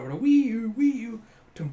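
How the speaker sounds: high, loud imitated sirens; siren sound effects. Narrating the urchins being hunted, siren noises. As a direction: make the siren noise with your voice.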